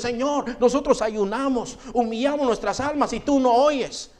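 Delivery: pleading voice. Retold or imitated. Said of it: "decían los hombres".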